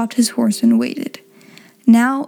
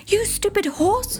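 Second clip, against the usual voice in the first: high, slow strained yell; terrified rider yells at his horse, high and slow; shouted; 10 semitones higher, 6 dB quieter than usual. A narrator's reading. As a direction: cry out in a pitched-up panic at the horse. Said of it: "shouted Ichabod".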